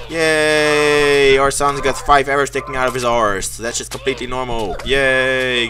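usual speaking voice

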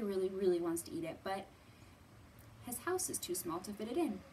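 normal talking voice

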